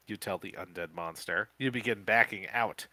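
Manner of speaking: usual speaking voice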